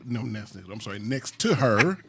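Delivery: usual speaking voice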